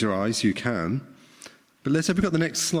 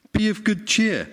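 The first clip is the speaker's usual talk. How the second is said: Jesus calling out; 9 semitones higher than usual. Acted. Jesus calls to the disciples.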